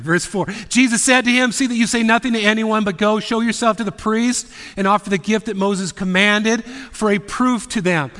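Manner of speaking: forceful preaching of the verse; preaching scripture. Acted. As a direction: proclaim the verse to the room.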